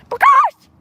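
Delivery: very high, loud screechy call. Acted. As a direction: shriek the word out. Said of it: "No, that was screechy".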